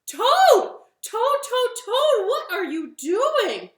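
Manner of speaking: high, loud, repeated calling; alarmed shouting. Acted. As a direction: shout to stop a friend mid-tantrum. Frog alarmed by the commotion.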